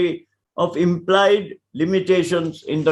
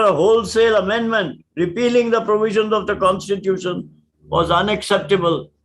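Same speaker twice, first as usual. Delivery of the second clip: ordinary talk, nothing marked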